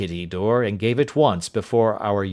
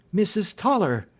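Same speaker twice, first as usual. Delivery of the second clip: surprised cry; 10 semitones higher than usual. Acted. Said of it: "cried Miss Hunter".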